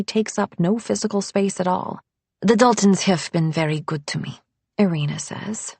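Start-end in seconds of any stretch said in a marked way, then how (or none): 2.4-4.4 put-on polite Eastern European accent; restrained housekeeper, Eastern European accent. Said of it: "Eastern European accent".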